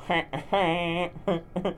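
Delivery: high nasal wheezing honk of a laugh; Squidward laugh. Shown as a demonstration. Doing a Squidward laugh for the audience, high wheezy. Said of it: "me doing a Squidward laugh".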